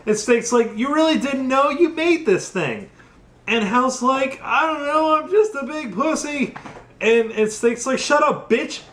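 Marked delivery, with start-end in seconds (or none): 0.7-2.9 incredulous yell. Retold or imitated. Snake yelling at Hal. 4.4-6.5 whiny coward. Retold or imitated. Hal whining in fear. 8.0-8.8 mock snapping. Snake snaps at Hal.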